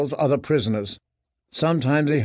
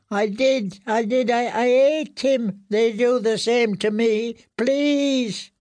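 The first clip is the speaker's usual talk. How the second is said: high, halting, whimpered confession; frightened mumble; 10 semitones higher, slower than usual. A narrator's reading. Prisoner confesses eating the rat and begs, frightened mumble. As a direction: plead in a high, drawn-out, stumbling whimper. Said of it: "he mumbled".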